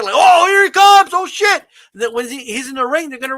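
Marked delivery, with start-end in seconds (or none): 0.2-1.6 loud, high shout of a surprised fan; crowd erupting. Retold or imitated. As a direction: yell it like a fan seeing Stone Cold's entrance. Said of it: "an organic".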